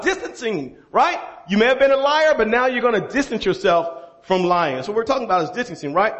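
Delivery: ordinary delivery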